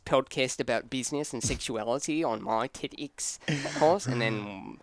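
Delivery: put-on Australian with upward inflections; Australian accent. Shown as a demonstration. Host demonstrating on request to friends, Australian accent. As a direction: explain it in a mock Australian TED-talk accent. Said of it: "How's your Australian accent?".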